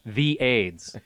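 "The" said slowly as "thee", set apart; slow, deliberate "The aids"; stress on THE. Shown as a demonstration. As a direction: lean on "The" as a long "thee". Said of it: "Not aids, but The aids".

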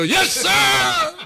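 loud high shouted reply; Marine shout. Retold or imitated. Marines answering in unison, loud high shout. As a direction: yell it like a whole platoon.

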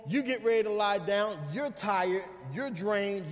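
normal talking voice

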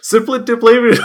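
high, loud laughing words. Real, not acted. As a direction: crack up mid-word. Laughing over made-up title.

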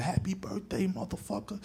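mocking call from a distance, imitated; taunt from the background. Prankster calls after the fleeing boy, distant taunt. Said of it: "he laugh in the background".

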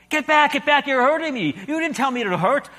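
high, loud cry of pain; panicked ghost. A narrator's reading. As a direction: push him off, hurt and scared.

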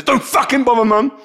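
high, loud retort; angry snap. A narrator's reading. Shai rejecting his mum after the video, angry snap.

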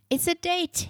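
mild yell of discovery, pitched right up; excited yell. A narrator's reading. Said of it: "he yelled".

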